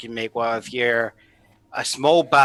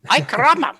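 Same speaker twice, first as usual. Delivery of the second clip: loud hammy Mexican exclamation; exaggerated cartoon Mexican; mock Mexican accent; 10 semitones higher, 6 dB louder than usual. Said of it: "speak in a mock Mexican accent".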